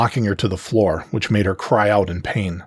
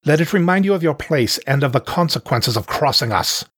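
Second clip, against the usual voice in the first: higher, menacing proclamation; smug panther leader; 6 semitones higher than usual. Acted. Panther warns the watching exiles, smug menace. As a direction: warn them like a gloating chief.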